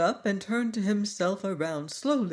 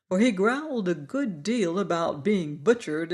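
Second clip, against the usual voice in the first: plain reading voice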